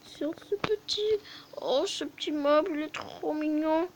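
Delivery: cute cooing voice. Real, not acted. Gamer cooing at a cute Minecraft mob, high cute voice. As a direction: gush like it's a puppy.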